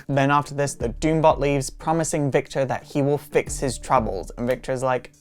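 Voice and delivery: usual speaking voice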